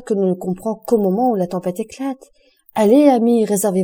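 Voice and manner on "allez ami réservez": rousing urge. Acted. Beauchamp to Albert, urging him to keep his strength: rousing call. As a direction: push him forward with energy.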